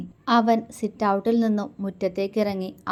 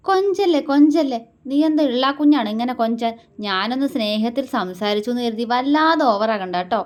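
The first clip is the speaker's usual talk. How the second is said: much higher and louder fake scolding; mock anger; 4 semitones higher, 6 dB louder than usual. A narrator's reading. Husband faking anger at her coaxing, loud mock scold. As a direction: pretend to be cross, hiding a laugh. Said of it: "കപട ദേഷ്യത്തോടെ നോക്കി".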